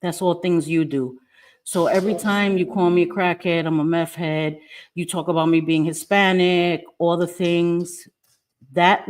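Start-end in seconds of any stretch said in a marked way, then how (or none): none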